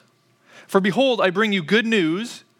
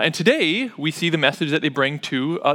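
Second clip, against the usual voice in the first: ordinary delivery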